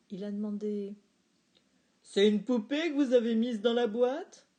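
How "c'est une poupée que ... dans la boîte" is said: louder, sharper repeated challenge; angrier dad. A narrator's reading. Dad challenging again, louder and angrier. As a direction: press harder this time.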